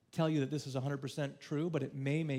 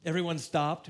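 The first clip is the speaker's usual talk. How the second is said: ordinary talk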